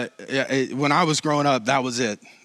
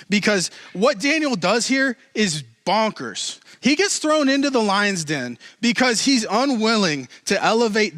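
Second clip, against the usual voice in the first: full-force preaching; 9 semitones higher than usual. Acted. Preacher driving home Daniel's boldness to the congregation, fervent full-force preaching. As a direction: preach it with everything you've got.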